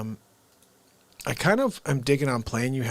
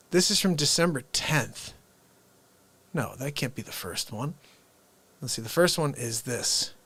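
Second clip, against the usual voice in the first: ordinary talk, nothing marked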